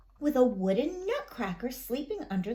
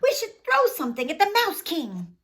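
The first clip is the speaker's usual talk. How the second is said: urgent; 4 semitones higher, 6 dB louder, faster than usual. A narrator's reading. Urgent plan during battle.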